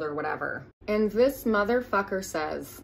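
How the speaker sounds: ordinary talk, nothing marked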